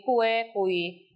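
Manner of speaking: ordinary talk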